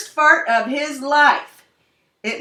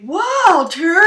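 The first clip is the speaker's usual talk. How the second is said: loud, high accusing call of a name; sudden blaming shout; 8 semitones higher, 8 dB louder than usual. Acted. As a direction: yell the name to pin the blame. Uncle blames dog by shouting.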